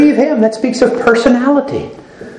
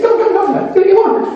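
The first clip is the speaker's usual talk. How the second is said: laughing high plea; 11 semitones higher than usual. Retold or imitated. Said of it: "I said".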